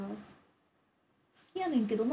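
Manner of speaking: a light attempt at Kansai dialect, copying her line; imitating Kansai dialect. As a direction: parrot her Kansai phrase playfully. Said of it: "関西弁羨ましい". (関西弁をまねて軽く)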